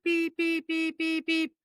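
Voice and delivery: high mock beeping of a truck backing up; truck reversing beeps. Sports host imitates a bus backing up, high mock beeps. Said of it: "Back up the bus, Gus".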